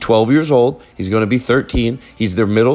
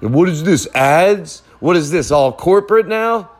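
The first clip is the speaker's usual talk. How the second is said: higher-pitched mild indignation; lightly voiced suspicious fan; mock complaining listener; 5 semitones higher than usual. Paranoid host voices listeners doubting ads, mild mock complaint. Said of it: "Were you thinking that, anybody?".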